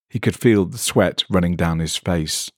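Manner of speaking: plain reading voice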